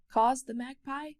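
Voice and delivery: ordinary delivery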